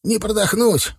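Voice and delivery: hoarse strangled plea; hoarse wheeze. Acted. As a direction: gasp it out hoarsely under a knee on the chest. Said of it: "прохрипел он".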